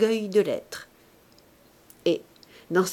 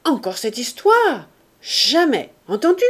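loud, raised-pitch outburst of anger; angry cry; 5 semitones higher, 7 dB louder than usual. A narrator's reading. Husband refusing his wife's plea to return to Paris, angry cry. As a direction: shout it down in anger, higher and louder. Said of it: "Il cria d'une voix de colère".

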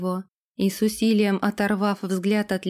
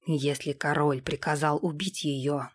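low, softer, even voice; composed retainer Sam; 5 semitones lower, 5 dB quieter than usual. A narrator's reading. Sam confesses a royal order to the lord, composed. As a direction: report it quietly and impassively.

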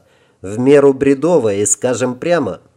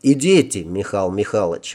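husky, voice catching; suddenly hoarse; 4 semitones lower than usual. Acted. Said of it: "внезапно охрипшим голосом сказал я".

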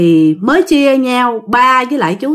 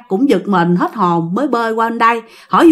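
ordinary delivery

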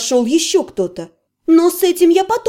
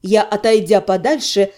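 usual speaking voice

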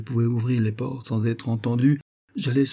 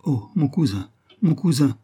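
higher-pitched, mildly distressed exclamation; a narrator's restrained cry of distress; trembling cry; 5 semitones higher than usual. A narrator's reading. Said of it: "s'écria-t-elle d'une voix tremblante". (cri ému d'une voix tremblante, avec retenue)

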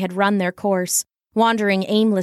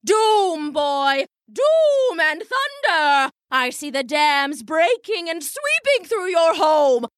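higher, booming stage prophet; stage bellow; 6 semitones higher than usual. Acted. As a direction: bellow doom over the boy. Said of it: "her trained stage bellows".

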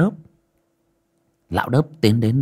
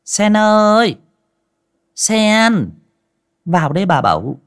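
loud, much higher call from another room; 11 semitones higher, 5 dB louder than usual. Acted. Mistress calls the maid from the inner room, loud and higher. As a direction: call her in from the back room. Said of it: "mụ gọi vọng ra".

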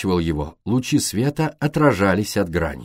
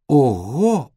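mildly raised "wow"; a higher, impressed exclamation; surprised exclamation; 4 semitones higher than usual. A narrator's reading. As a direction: exclaim at a sudden find. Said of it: "воскликнула Бриджит".

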